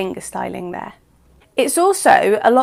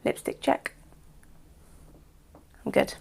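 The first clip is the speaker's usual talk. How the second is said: unvoiced muttered aside; whispering; 13 dB quieter than usual. Real, not acted. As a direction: whisper it to yourself. Checking teeth for lipstick.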